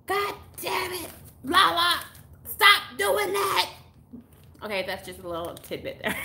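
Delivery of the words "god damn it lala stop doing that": put-on mom yelling at a child to stop; mama screaming. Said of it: "I remember my mama screaming".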